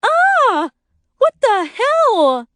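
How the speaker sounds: very high, loud shriek of shock; drawn-out horrified yell; startled scream. A narrator's reading. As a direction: scream as if a gnome jumped out at you. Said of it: "Lori screams".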